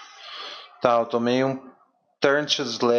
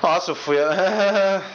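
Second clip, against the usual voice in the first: surprised; 7 semitones higher, 3 dB louder than usual. Real, not acted. Sudden surprise at opponent.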